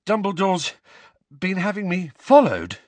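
high, halting question; panting. A narrator's reading. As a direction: break the question up for breath. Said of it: "Harry panted".

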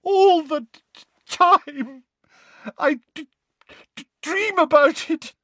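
high, loud stammer through sobs; Molly sobbing on Lupin's shoulder; crying. A narrator's reading. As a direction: choke the words out, weeping.

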